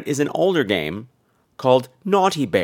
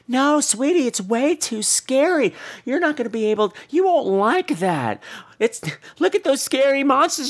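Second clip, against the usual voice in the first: high, pleading parent voice; pleading dad; 11 semitones higher than usual. Retold or imitated. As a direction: play a dad desperately dissuading his child. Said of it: "the fellow's like".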